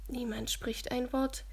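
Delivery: ordinary delivery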